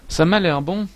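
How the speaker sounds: high, loud, hammed-up Québécois accent; exaggerated Quebec French accent, playful; mock Québécois accent. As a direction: throw on a jokey Québécois twang. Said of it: "mon accent ridicule".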